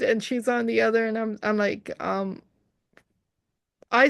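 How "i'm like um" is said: ordinary talk, nothing marked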